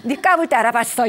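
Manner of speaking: loud, exasperated I-knew-it jab; scolding parent. Retold or imitated. Parent inwardly blaming the child, sharp and loud. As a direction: snap at the kid with told-you-so. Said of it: "이런 마음이".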